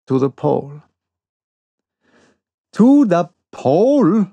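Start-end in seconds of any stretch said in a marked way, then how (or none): 2.7-4.3 incredulous cry, higher and louder; exclaimed in disbelief. A narrator's reading. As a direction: blurt it out in disbelief. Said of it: "I exclaimed".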